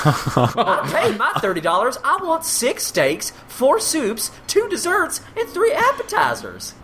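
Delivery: demanding diner. Acted. Steakhouse diner who paid thirty dollars, high and demanding. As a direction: list the order like a greedy mock customer. Acting greedy steakhouse customer.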